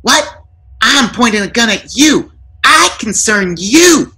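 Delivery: rising outrage at the intruders; high angry burglar. A narrator's reading.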